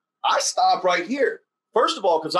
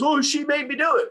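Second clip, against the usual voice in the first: put-on high, whiny little kid; whiny child excuse; 10 semitones higher than usual. Young son James blaming his sister, high whine. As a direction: whine out the excuse like a six-year-old. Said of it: "you tell James".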